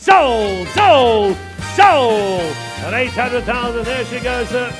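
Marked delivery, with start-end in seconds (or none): 0.0-2.6 loud, triumphant sold; shouting sold. Real, not acted. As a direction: bang it out like a hammer. Hammer falls.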